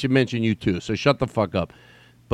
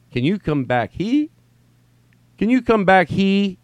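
rising higher and louder with each try; puzzled retries; 8 semitones higher, 4 dB louder, slower than usual. Acted. Reader stuck on the typo, increasingly puzzled and high. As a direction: push "he" up into a high question.

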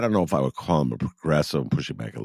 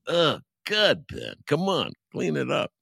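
self-scolding; 14 semitones higher than usual. Retold or imitated. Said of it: "I go".

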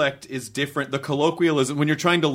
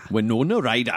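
copied, lower Newcastle accent; attempted Geordie accent; 4 semitones lower than usual. Shown as a demonstration. Host copying the actor's key phrase, attempted Geordie accent. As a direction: echo it in Geordie. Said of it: "There you go, you're a Geordie".

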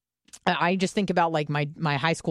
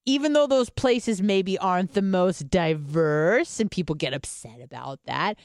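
normal talking voice

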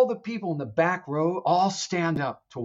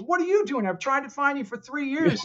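high, scolding exasperation; scolding reply; 12 semitones higher than usual. Retold or imitated. Gabriel scolding Constantine for hiding, high scolding.

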